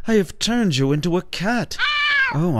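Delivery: ordinary delivery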